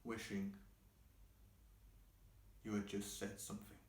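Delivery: soft, sinking voice with long pauses; subdued regret. Acted. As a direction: let it fall away quietly, phrase by phrase. Monologue sinks into regret.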